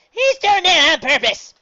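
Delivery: high, loud accusing yell; indignant shout. Acted. Granny accusing the pitcher, angry shout. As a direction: accuse him at the top of your lungs. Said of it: "Granny protested".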